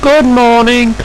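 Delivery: high-pitched boss's greeting; Thin Controller. Acted. Controller greeting his engines, high and brisk. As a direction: greet the workers in a high put-on voice. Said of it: "greeted the thin controller".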